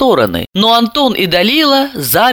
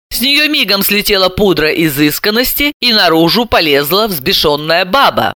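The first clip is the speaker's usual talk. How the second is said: usual speaking voice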